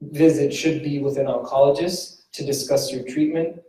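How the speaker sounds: ordinary delivery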